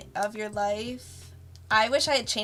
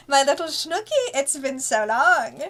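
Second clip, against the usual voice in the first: Excel's voice; 5 semitones higher, 6 dB louder than usual. Retold or imitated. Character dotes on her godchild, gushing and theatrical. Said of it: "I can't do an impression of her without doing the arms".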